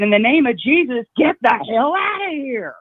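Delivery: high, emphatic mock yell; retold scream. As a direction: act out screaming someone away, without real volume. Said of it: "I was screaming at nothing".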